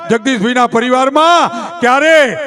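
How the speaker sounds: normal talking voice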